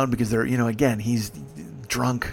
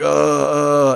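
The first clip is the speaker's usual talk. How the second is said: queasy moan; 4 dB louder than usual. Retold or imitated. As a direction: moan like you may throw up. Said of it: "like, moaning".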